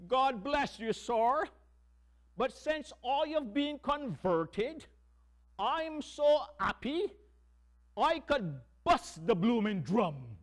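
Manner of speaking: high, loud, slow Cockney drummer voice. Retold or imitated. Drummer answering the band leader, in a loud Cockney accent. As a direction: beam it out in a Cockney accent, bursting with joy. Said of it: "And in his Cockney accent".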